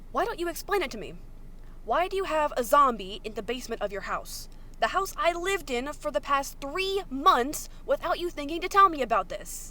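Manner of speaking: building angry yell at a secretive friend; angry shouting. Acted. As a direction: yell at him, betrayed and furious. Virgil yells at Logan.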